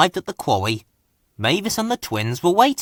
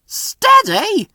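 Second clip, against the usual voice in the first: much higher call across the yard; 4 dB louder than usual. A narrator's reading. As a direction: call out to slow him down. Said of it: "called Fergus".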